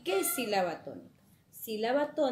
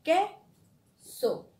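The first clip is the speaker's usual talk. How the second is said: "Queso" split in two, QUE loud and high; punched QUE, a pause, then a falling "so"; stress on QUE; 6 semitones higher, 6 dB louder than usual. Shown as a demonstration. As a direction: break "Queso" into syllables with force on QUE. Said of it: "que, ¿verdad?".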